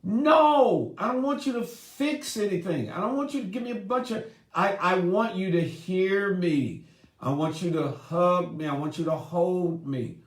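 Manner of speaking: high, pleading wife voice that settles down. Retold or imitated. Wife to her fixing husband, high pleading. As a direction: beg him to just listen. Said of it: "And women are like".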